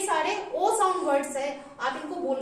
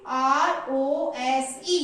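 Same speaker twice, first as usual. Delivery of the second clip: slow spelling; 4 semitones lower, much slower than usual. Shown as a demonstration. Teacher spelling rose for pupils, very slow. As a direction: spell आर ओ एस ई extremely slowly. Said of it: "तो क्या बन गया ये".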